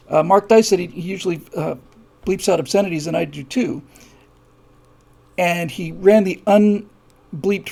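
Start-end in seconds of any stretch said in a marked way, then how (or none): none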